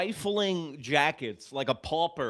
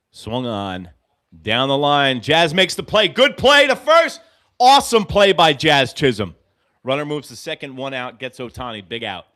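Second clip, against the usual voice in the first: live play-by-play; 11 dB louder than usual. Acted. Podcaster calls a baseball inning live, excited play-by-play. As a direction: call the inning like a radio announcer.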